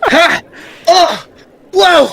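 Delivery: short, high, loud yelps; loud, high "ha, oh, whoa" outbursts; startled exclamations. Acted. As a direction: react in sharp separate bursts. Acting a startled reaction at the table.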